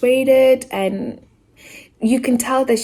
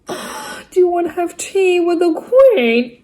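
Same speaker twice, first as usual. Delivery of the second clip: a slow, higher, bad attempt at a posh British accent; failed British accent; 9 semitones higher, 3 dB louder, slower than usual. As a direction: ham up a British accent and miss it. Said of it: "That was not a British accent".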